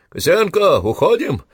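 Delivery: high, loud call into the distance; shouting. A narrator's reading. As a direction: shout orders across the dark. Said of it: "крикнул Иванцов в темноту".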